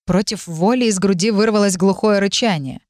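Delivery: ordinary delivery, nothing marked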